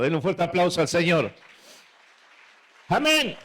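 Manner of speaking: loud call for applause; rallying shout. Real, not acted. Preacher calls the congregation to applaud the Lord, rallying shout. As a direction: shout it out to rouse the room.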